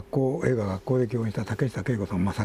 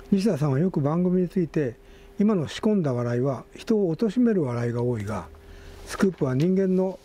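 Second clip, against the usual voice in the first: higher, steadier reading voice than in chat; article read-aloud; 7 semitones higher than usual. Real, not acted. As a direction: read the tribute out to the listeners.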